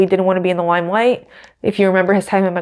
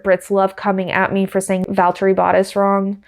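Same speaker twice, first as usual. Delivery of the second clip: ordinary delivery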